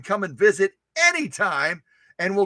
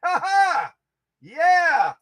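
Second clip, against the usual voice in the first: excited whoop; 11 semitones higher, 4 dB louder than usual. Real, not acted. Host hailing the new viewer, whooping. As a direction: holler it out high, like an announcer.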